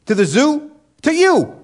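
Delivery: put-on high, loud sing-song children's announcer; mock singsong kids' host. Pastor parodying evolution for kids, loud sing-song. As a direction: ham up the goo-to-zoo jingle, slowly. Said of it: "Kinda like".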